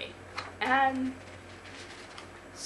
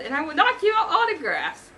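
loud, raised-pitch refusal; indignant yell; 8 semitones higher, 8 dB louder than usual. A narrator's reading. Thor refusing the fan an autograph, indignant yell. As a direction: keep yelling, firmly refusing. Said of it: "Thor yelled".

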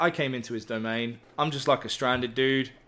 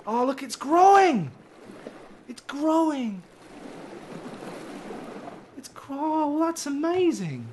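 much higher, squealing with joy; real giddy excitement; delighted; 12 semitones higher than usual. Real, not acted. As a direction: gush over the sprouting tree. Plant starts growing.

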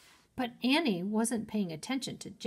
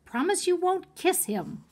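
rising insistence, much higher; higher, pleading Jack; 10 semitones higher, 4 dB louder than usual. Acted. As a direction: pitch it up, exasperated. Jack's rising string of demands.

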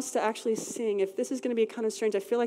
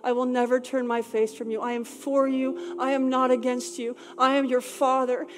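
louder and higher, preaching God's promise; fervent prophetic declaration; 3 semitones higher, 5 dB louder than usual. Acted. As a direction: proclaim it with rising conviction. Prophetic declaration at full force.

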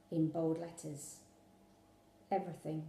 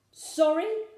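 indignant high echo, louder and higher; fuming; 8 semitones higher, 7 dB louder than usual. A narrator's reading. A father fuming at his daughter's apology, indignant. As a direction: throw her word back at her in disbelief. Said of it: "Dad fumed".

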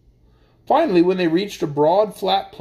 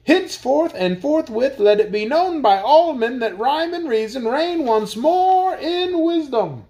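royal proclamation; 6 semitones higher than usual. A narrator's reading. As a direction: proclaim it to the crowd.

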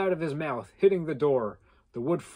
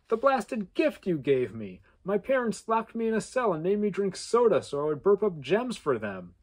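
shouting; 6 semitones higher than usual. Acted. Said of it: "Trevor shouted".

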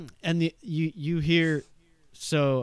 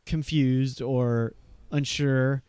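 ordinary delivery

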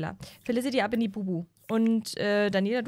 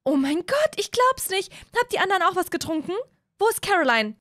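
higher-pitched disappointed mother scolding; higher mom voice telling the boys off; 8 semitones higher, 4 dB louder than usual. Acted.

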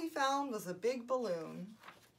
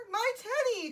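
high, loud storybook cry; cried out; 11 semitones higher, 6 dB louder than usual. A narrator's reading. Eddie seeing his teddy, crying out. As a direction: call out urgently, pitched up. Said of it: "cried Eddie".

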